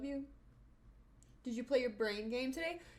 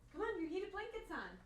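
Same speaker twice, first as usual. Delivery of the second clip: higher, softer coaxing voice; 9 semitones higher, 9 dB quieter than usual. Real, not acted. Owner coaxing dog to his heated blanket, baby talk. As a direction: coax him onto the blanket.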